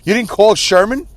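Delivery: hammed-up accusing disbelief; mock angry. Brother to sister about the funeral home, mock angry. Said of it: "That's how I'm gonna sound".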